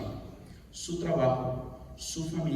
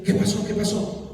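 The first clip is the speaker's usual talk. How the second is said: high, louder anxious demand; worried; 7 semitones higher, 5 dB louder than usual. Retold or imitated. Husband cutting into greetings on the phone, worried. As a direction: re-enact cutting in with urgent worry. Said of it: "Yo estaba preocupado".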